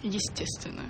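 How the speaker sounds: breathy whispered aside. Real, not acted. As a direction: whisper it like a secret. Whispered answer.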